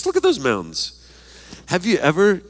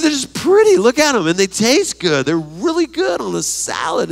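much higher and louder, savoring each food; gushing delight; 9 semitones higher, 5 dB louder than usual. Real, not acted. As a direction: rave about it with joy. Gushing over food pictures.

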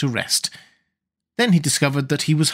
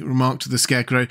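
usual speaking voice

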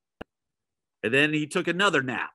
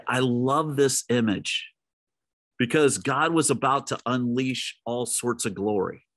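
ordinary delivery

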